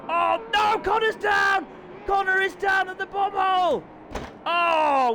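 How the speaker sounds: high, loud alarmed yell; shocked shout. Real, not acted. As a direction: cry out at the crash. Crash during live commentary.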